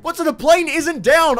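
loud, high, exasperated yell; startled shout. Real, not acted. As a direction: yell it out in disbelief. Plane surprise mid-game.

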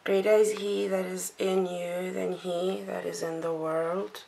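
normal talking voice

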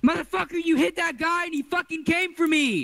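high, exasperated blaming; heated accusation. Real, not acted.

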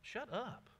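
lightly voiced Jesus shutting John down; Jesus rebuking. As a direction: brush him off in a put-on voice. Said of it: "Jesus looks at them and goes".

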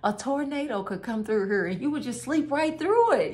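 lightly voiced old grandmother; imitating grandmother. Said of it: "my grandmother used to say".

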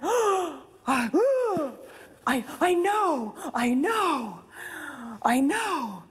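high, breathless cries between gasps; imitated urgent gasping, stretched words; gasping revelation. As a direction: act out someone jolted back to life. Acting out man's gasping revival.

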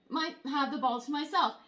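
ordinary delivery

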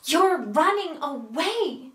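acted exasperated outburst without shouting; insistent accusation. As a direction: drive each word home, pleading to be heard. Acted confrontation, repeated with force.

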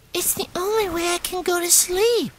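put-on very high, tiny child voice; high-pitched small kid; little sister's voice. A narrator's reading. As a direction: squeak it like a little girl.

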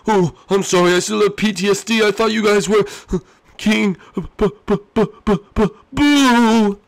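high, loud scared stutter; terrified little ghost; high stammering ghost voice. Acted. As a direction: stammer out a frightened name.

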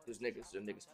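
low, soft hushed warning. Retold or imitated. Telling his girl on the phone, hushed warning. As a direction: whisper the warning.

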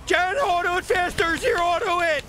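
very high-pitched urgent yell; desperate, high shouted command; frantic shouting. Real, not acted. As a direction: scream orders at your teammates. Screams orders as game ends.

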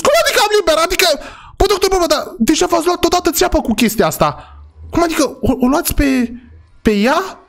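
high-pitched, incredulous protest; indignant outburst. Acted. Indignant at being sent away.

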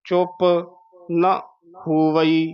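ਚੁੱਪ, ਨ, ਹੋਵਈ each alone; words separated, pause. Shown as a demonstration. Teacher modelling the phrase and its rest, word by word. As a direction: leave a gap after each of ਚੁੱਪ, ਨ, ਹੋਵਈ. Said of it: "ਇੱਥੇ ਵਿਸਰਾਮ".

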